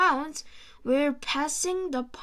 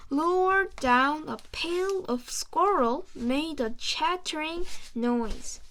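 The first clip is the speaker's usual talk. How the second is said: usual speaking voice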